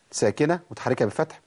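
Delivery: ordinary talk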